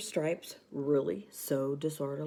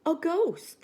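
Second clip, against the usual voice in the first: high, loud scared scream of a ghost; 13 semitones higher, 4 dB louder than usual. A narrator's reading. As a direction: shriek it in panic. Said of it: "the animals screamed".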